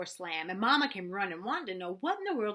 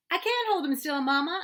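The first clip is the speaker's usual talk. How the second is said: high, loud panicked shout; 8 semitones higher, 10 dB louder than usual. A narrator's reading. Boy yelling to Mama that he can't hold the goose, high loud shout. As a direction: yell over the honking goose. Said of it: "I shouted".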